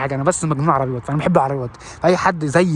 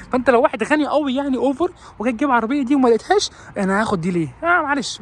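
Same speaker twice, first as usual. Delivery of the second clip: high, pitying car-dealer voice; a high put-on voice of a smug dealer; mock salesman; 8 semitones higher than usual. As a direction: pity the poor customer in a high voice.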